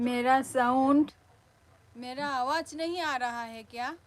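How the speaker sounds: real loud, questioning call; loud sound check. Real, not acted. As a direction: project hard to test the mic. Checking whether sound gets through.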